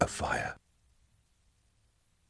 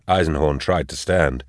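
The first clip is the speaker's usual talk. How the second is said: usual speaking voice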